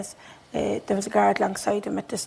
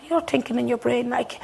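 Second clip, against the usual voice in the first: normal talking voice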